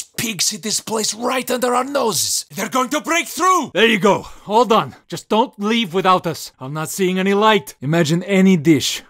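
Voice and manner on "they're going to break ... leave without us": ordinary delivery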